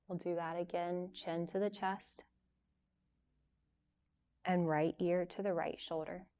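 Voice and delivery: soft, unhurried yoga teacher voice with long pauses; guided yoga instruction. Real, not acted. Yoga teacher leading a class through gentle stretches before relaxation, calm guiding voice. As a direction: guide them softly through each stretch, leaving room to move.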